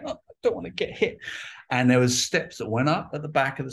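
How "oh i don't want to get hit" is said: put-on high, quick scared little boy; little kid voice. Kid in the bike shop fearing the handlebars, high and quick. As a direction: squeak it out like a nervous small kid. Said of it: "as a little kid going".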